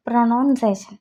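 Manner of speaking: normal talking voice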